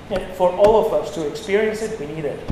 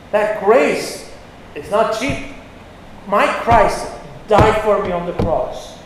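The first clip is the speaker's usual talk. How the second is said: ordinary talk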